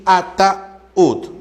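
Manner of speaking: "ataúd" very slow, broken before ú; hiatus shown. Shown as a demonstration. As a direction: split "ataúd" as a-ta-úd. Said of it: "hiato acentual".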